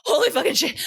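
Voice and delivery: high, mock-panicked cursing; panicked exclamation. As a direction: blurt it like you just escaped a killer. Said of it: "You'd be like".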